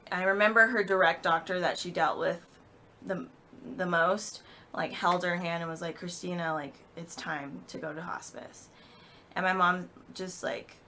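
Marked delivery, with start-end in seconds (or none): 6.0-8.7 gently breaking news. Retold or imitated. Doctor holding patient's hand, gently breaking news. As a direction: say it softly, easing the patient into it.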